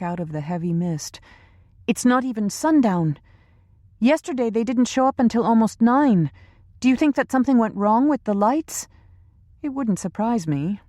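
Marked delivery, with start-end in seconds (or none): 1.9-10.8 higher, lightly worried woman; young woman voice. A narrator's reading. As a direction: ask it a little uneasily. Lisa voiced by narrator.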